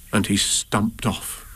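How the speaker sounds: ordinary delivery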